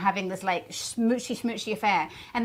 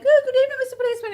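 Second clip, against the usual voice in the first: high, sweet innocent greeting; overly polite falsetto; 13 semitones higher, 8 dB louder, much faster than usual. Acted. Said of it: "and they're like".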